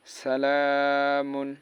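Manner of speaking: সালামুন with the long vowel drawn out slowly; stretched vowel. Shown as a demonstration. Teacher drawing out সালামুন for students. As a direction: stretch সালামুন by one alif length. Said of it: "ওখানে শুধু এক আলিফ পরিমাণ টান হবে".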